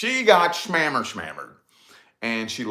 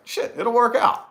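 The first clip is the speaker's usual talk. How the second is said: higher, light, carefree imitation; easygoing friend; 10 semitones higher, 4 dB louder than usual. Remembering friend Case calmly waving off the worry, carefree. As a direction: shrug it off like nothing matters. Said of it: "that look on Case's face when he's just like".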